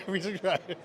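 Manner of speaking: pre-subscribed said through a laugh. Real, not acted. Repeats joke while laughing.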